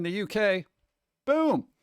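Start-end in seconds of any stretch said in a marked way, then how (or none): 1.3-1.7 excited. Real, not acted. Excited news reaction.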